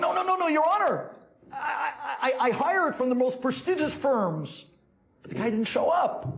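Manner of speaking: high, stammering defendant; acted flustered protest; panicked pleading. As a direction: plead frantically with the judge. Defendant pleads with angry judge.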